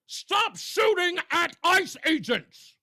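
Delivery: high, loud, slow yelled plea; mock scream. Host voicing what the congressman should yell to his folks, mock shout. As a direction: shout it like you want the crowd to hear. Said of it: "I don't hear you screaming".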